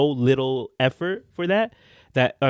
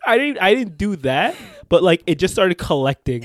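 laughing; 7 semitones higher, 6 dB louder than usual. Real, not acted. Laughing story.

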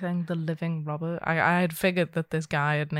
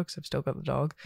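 ordinary talk, nothing marked

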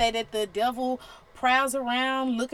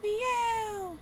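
high, small mock meow; a preacher mocking the devil as a house cat; tiny cat meow; 12 semitones higher, 3 dB quieter than usual. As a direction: make the devil a harmless kitten. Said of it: "He's really just".

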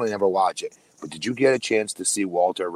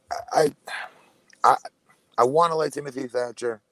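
talking with mouth full of food; words pushed around a mouthful; 7 semitones higher, 4 dB louder than usual. Real, not acted. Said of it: "a mouthful of food here".

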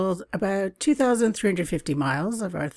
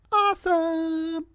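excited cheer; 10 semitones higher, slower than usual. Real, not acted. Host cheering listeners who love travel.

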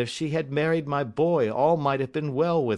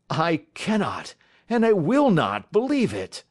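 emphatic refusal, rising louder and higher; anguished cry; 4 semitones higher, 4 dB louder than usual. Acted. Uncle refusing to believe it of his niece, crying out.

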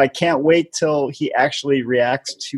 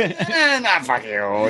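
cameraman impression; 3 semitones higher, 3 dB quieter, much slower than usual. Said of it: "He's like".

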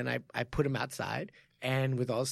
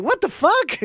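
high, loud disbelief; loud high what the fuck; incredulous; 11 dB louder, slower than usual. Retold or imitated. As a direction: shriek it in disbelief. Incredulous reaction retold.

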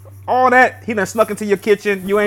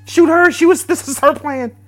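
high-pitched blame-shifting plea; playfully panicked, pitched-up begging; mock panicked plea; 9 semitones higher, 3 dB louder, slower than usual. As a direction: plead in a high voice, shoving blame elsewhere. Mock pleading with armed game villain.

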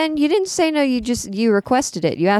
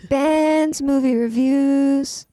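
slow drawn-out announcer voice, higher; mock announcer; 7 semitones higher, much slower than usual. Co-host echoing the segment title in a slow, higher announcer voice. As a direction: stretch the title like a jingle.

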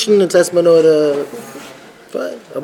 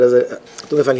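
ordinary delivery

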